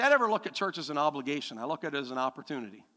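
ordinary talk

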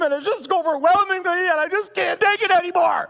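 put-on high-pitched overwhelmed child's whine; mock crying. Pessimist son complains to dad about his toys, mock crying. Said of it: "sitting there in the middle of the room crying".

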